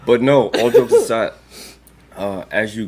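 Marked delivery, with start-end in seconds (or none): 0.1-1.3 laughing loud. Real, not acted.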